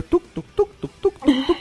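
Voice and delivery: high tuc-tuc tapping noises; tapping sound. Retold or imitated. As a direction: tap it out in high little clicks. Imitating tapping pedal.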